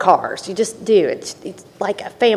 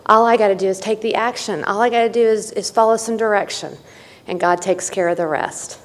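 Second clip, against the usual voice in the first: ordinary talk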